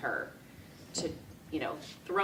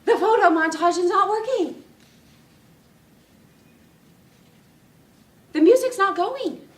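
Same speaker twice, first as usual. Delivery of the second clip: much higher, much louder frantic alarm; panicked mom; 13 semitones higher, 13 dB louder than usual. Retold or imitated. Mom bursting in to tell the bride the montage failed, frantic. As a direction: burst in shrieking that nothing works. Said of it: "and says".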